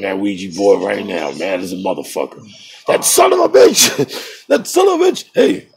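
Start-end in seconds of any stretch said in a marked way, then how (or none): 2.9-4.3 high, slow, loud outraged yell; shocked outburst. Real, not acted. Sudden outburst at someone. 4.5-5.2 high loud yell breaking into a laugh; laughing outburst. Real, not acted. Repeats outburst through laugh.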